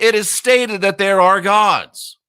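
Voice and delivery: "stated" and "gods" hit hard; emphatic declaration. Real, not acted. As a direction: drive home "it is stated" high and firm. Hammering his reading of verse.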